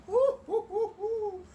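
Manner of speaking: high, loud whooping laugh; real laughter. Real, not acted. Retelling the rude phone call, cracking up with loud whoops. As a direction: laugh out in disbelief. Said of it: "I started laughing".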